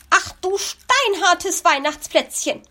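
startled magpie shout; cry out. Acted. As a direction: yelp at the rock-hard cookie. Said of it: "schrie sie auf".

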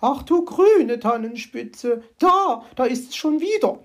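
high, loud, slowed shriek of fright; horrified cry. Acted. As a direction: cry out in alarm as the magpie. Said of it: "schrie sie entsetzt auf".

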